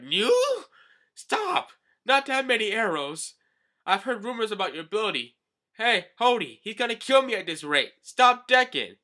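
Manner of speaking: very high, loud panicked cries; panicked plea. Acted. Wounded Hachi begging Hody to stop Decken. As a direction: scream for them to stop.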